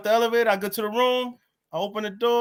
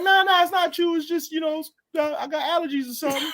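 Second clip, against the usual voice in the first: high, tearful excuse-making; mock tearful denial; 9 semitones higher than usual. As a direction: imitate denying tears while weepy. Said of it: "I'm crying again".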